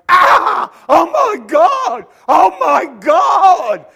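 high, very loud, slow shrieks; crowd screaming. Retold or imitated. Crowd sees the new house, shrieking. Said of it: "everyone goes".

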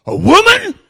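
very high, very loud Alabama disbelief; mock Alabama accent. Driver hearing of a queen, astonished Alabama shriek. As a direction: blurt it in a shocked, high Alabama voice. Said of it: "This accent is a Vaughnabama accent".

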